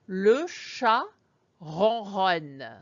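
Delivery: ronronne with throaty R's; le chat ronronne, purr the R's; purring French R. Shown as a demonstration. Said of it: "Let me say it again".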